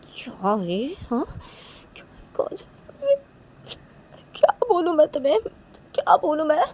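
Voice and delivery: high-pitched distressed helplessness; upset, at a loss. Acted. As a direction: plead as if lost for words. Acted upset over friend's injuries.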